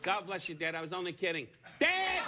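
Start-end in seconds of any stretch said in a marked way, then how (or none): 1.8-2.2 calling out. Acted. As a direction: shout "Dad!" across the room. Calling out to the father.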